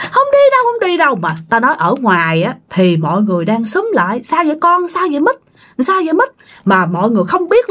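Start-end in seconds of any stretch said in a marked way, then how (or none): 0.1-1.2 very high, panicked refusal; child crying out. A narrator's reading. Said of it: "bạn giãy, bạn kêu". 4.2-6.3 high, anxious questioning; alarmed onlookers. A narrator's reading. Onlookers crowd round screaming child.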